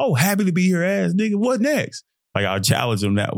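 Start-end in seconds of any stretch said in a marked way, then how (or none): 0.0-2.0 high, teasing jab at a teammate; mocking teammate taunt. Taunting teammates to get them fired up, high mock jab. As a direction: needle them with a higher mocking lilt. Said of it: "to get my teammates into the game".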